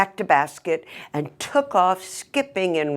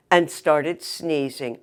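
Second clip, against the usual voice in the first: ordinary delivery, nothing marked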